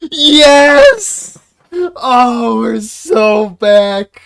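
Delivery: ecstatic cheer. Real, not acted. Player cheering as Iku finally appears, ecstatic shouts. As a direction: whoop like your favorite just showed up.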